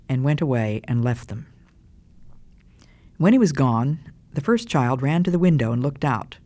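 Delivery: ordinary delivery, nothing marked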